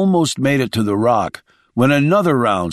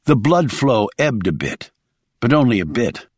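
plain reading voice

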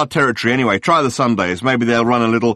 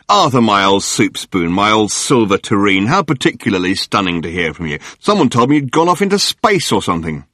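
gushing tabloid hack; 4 dB louder than usual. Acted. Journalist Murray greeting Arthur on the phone, gushing. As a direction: lay the flattery on thick.

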